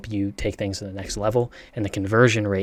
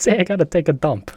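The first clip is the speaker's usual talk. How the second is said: higher, louder mimicked Boston accent; 7 semitones higher, 5 dB louder than usual. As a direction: parrot the line in a punched-up Boston accent. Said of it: "It's the Boston accent".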